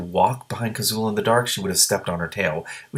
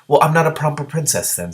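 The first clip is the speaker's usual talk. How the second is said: snapping; 7 semitones higher, 5 dB louder than usual. A narrator's reading. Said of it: "Simmarine snapped".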